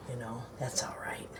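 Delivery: whispered aside; whispering. Real, not acted. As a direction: drop to a breathy secret aside. Aside about husband eating rolls.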